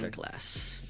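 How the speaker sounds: awed hushed whisper. Real, not acted. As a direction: breathe the word in awe. Awed after final result.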